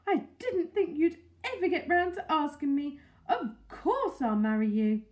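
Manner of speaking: loud, elated acceptance; excited crying out. A narrator's reading. Mrs Silver accepting Mister Hoppy's proposal, exclaiming. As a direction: accept with a joyful outburst. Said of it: "she cried".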